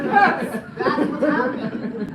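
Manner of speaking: excited laughing. Real, not acted. Excited loud reply, laughing.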